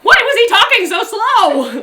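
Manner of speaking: high, loud, slow wail of impatience; drawn-out exasperated shout; exasperated yell. Acted. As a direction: cry out in frustration. Narrator exasperated with slow innkeep.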